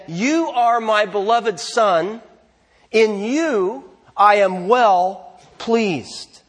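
slow, solemn voice from heaven; God's voice. Retold or imitated. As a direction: pronounce it slowly and grandly as God, stretching each phrase. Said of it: "and that voice said".

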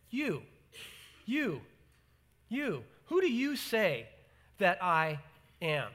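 soft, pointed "You, you, you" aimed at the listener; repeated emphatic you. Shown as a demonstration. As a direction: press each "you" quietly toward the congregation. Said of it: "What if Jesus were to ask that question of you".

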